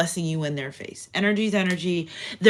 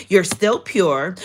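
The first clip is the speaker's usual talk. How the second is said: normal talking voice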